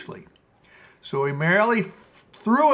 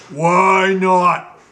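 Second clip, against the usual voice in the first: stamp and demand it like a spoilt tyrant king; Gnome King's tantrum; 2 semitones higher, 9 dB louder than usual. Acted.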